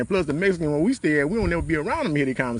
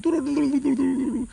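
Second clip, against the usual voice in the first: high, rapid-syllable gibberish imitating Spanish; mimicked Spanish chatter; 12 semitones higher than usual. Mama overhearing foreign talk on the phone, high mock-Spanish babble. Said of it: "that type of Spanish dialect".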